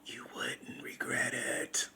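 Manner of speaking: slow, soft whisper; whispered promise. Real, not acted. Promising the viewers, a slow soft whisper. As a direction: lean in and whisper it.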